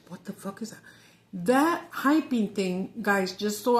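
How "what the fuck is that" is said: hushed, whispered disbelief. Retold or imitated. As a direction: breathe it out under your breath. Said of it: "I was like".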